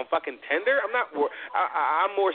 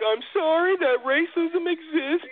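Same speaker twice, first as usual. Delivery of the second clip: imitating student; 13 semitones higher, slower than usual. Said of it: "She's like".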